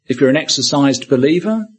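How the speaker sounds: ordinary delivery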